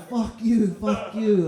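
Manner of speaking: loud, high mock shouting; mock road rage. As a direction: hurl it at another driver, half-joking. Angry driver retold again.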